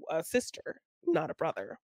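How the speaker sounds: usual speaking voice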